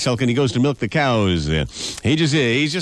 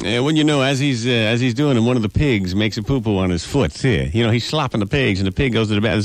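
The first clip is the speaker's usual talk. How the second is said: normal talking voice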